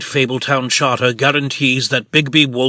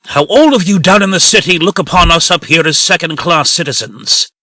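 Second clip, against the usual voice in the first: rising, louder, high and bitter; resentful outburst; 4 semitones higher, 5 dB louder than usual. Acted. Posey accusing Snow of looking down on the farm, resentful and loud. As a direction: hurl the grievance at her. Angry accusation against the city.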